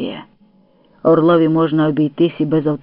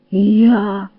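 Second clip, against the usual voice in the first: higher, lightly quavering reply; trembling voice; 4 semitones higher, 3 dB louder than usual. A narrator's reading. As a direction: answer with a touch of shivering. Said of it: "тремтячим голосом відповів Леонід".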